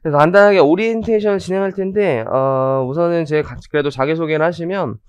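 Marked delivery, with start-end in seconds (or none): none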